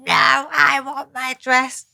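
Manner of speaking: high, slow mock toddler wail; imitating toddler. Toddler in the car seat wanting her dress, mock wail. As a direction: stretch it out like a sulking child. Mother voices screaming toddler.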